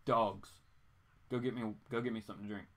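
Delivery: normal talking voice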